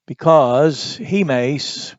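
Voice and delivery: "he" stretched out and heavily stressed, slow; emphasis on he. Shown as a demonstration. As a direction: lean hard on "he", slowly. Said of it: "notice all the emphasis here".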